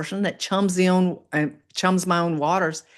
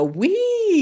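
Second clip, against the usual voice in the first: a squealed wee, far higher; playful wee. Pretend. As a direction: squeal like on a roller coaster drop. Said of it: "we can go".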